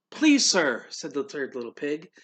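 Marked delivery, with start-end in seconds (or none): 0.1-0.9 eager pleading. Acted. Pig begging the man.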